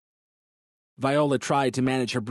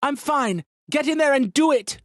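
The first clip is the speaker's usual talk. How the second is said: urgent shouting; 5 dB louder than usual. A narrator's reading. Viola urging Peter on.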